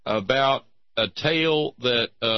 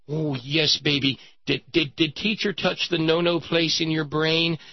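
higher, mock-worried parent voice; alarmed parent; 7 semitones higher, 4 dB louder than usual. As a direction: question the child with hammed-up alarm. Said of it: "they went to her and said".

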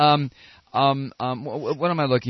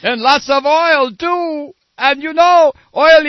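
put-on leftover French accent; 11 semitones higher, 13 dB louder than usual. Comedian stuck in his mock French accent after the clip. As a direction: still in the mock French accent, louder and higher. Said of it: "why am I talking like this? I'm not French".

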